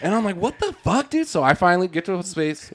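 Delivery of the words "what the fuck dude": high-pitched incredulous retort; high voice, no extra volume; exasperated disbelief. Retold or imitated. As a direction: retell a baffled protest, pitched way up. Baffled reaction to being yelled at.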